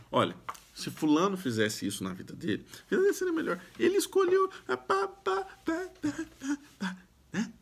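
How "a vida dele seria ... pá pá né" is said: high, sneering gossip voice, trailing off softer; sing-song put-down of someone else's choices; a nosy busybody passing judgment, high and snide; mock know-it-all.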